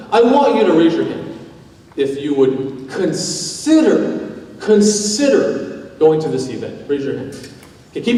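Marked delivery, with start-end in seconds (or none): none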